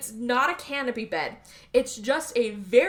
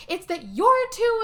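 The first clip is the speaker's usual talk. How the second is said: slow, high, raised mock anger; arguing; 10 semitones higher, 5 dB louder than usual. Husband turning on his wife, imitated angry accusation. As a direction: imitate an exasperated accusation, drawn out. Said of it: "they're arguing for a minute".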